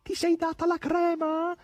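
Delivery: very high, slow called-out question; shrill cry. A narrator's reading. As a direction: cry it out high, drawing out the words. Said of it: "gridò Margherita con voce acuta". (domanda gridata con voce acuta)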